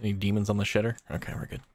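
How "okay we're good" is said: whispering. Real, not acted. Checking outhouse.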